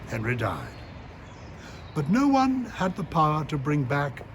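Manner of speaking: measured, deliberate poetry recital; steady recital with rising emphasis on key lines; poem reading. Acted. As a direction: read it like a poem with weight. Reads his poem aloud.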